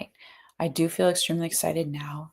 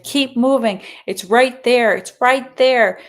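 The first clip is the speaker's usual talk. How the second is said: loud, high urging; urging shout; 7 semitones higher, 11 dB louder, slower than usual. Retold or imitated. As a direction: shout encouragement from the sideline. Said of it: "just like".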